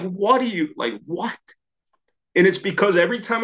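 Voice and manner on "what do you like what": high start, broken-off questions; stunned disbelief. Retold or imitated. Father hearing his daughter's amends, stunned.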